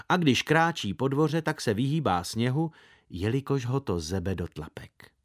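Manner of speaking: ordinary delivery, nothing marked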